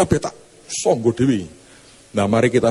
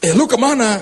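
competitive church lady; 11 semitones higher than usual. Acted. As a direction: refuse to be outdone. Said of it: "Yang seorang nggak mau kalah".

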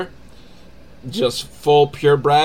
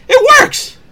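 high, loud burst of disbelief; triumphant exclamation; 10 dB louder than usual. Real, not acted. Reviewer revealing to listeners that the silly plan worked, triumphant exclamation. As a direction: shout it out, far up in pitch.

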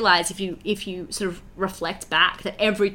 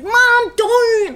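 much higher, loud bratty shout; exasperated teen yell; 10 dB louder than usual. Retold or imitated. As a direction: snap at mom like a fed-up teenager. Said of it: "Edna's like".

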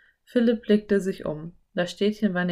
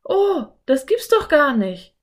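put-on higher, louder girl in disbelief; girl thrilled by a find; excited exclamation; 8 semitones higher, 6 dB louder than usual. A narrator's reading. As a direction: exclaim with delight. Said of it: "rief Anne".

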